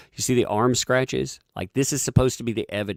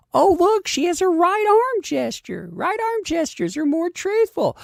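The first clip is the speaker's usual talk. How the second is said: high, loud gushing mock analyst voice; mocking media analysts; 11 semitones higher, 6 dB louder than usual. Mocking the body language expert to his viewers, high and gushing. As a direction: gush over every tiny gesture in a silly high voice. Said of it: "they're saying".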